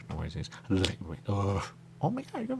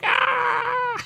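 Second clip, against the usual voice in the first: high cartoon shriek; 10 dB louder than usual. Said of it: "He was like".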